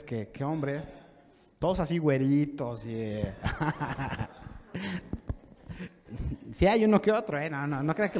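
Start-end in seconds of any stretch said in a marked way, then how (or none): 3.4-4.5 low chuckle at own joke; laugh. Real, not acted. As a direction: chuckle softly after the joke. (risa baja tras la broma)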